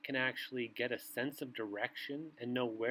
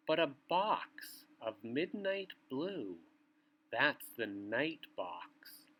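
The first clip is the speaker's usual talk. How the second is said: bedtime story reading; 3 semitones lower than usual. A narrator's reading. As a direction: read it like a bedtime book to little ones. Reads bedtime picture book aloud.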